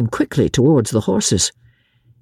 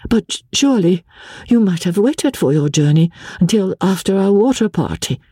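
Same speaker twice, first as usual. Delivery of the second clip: higher, hurried pleading; quick, nervous; 7 semitones higher than usual. A narrator's reading. Wife nervously begging husband to wait. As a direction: talk fast to keep him there. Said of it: "still speaking quickly and nervously".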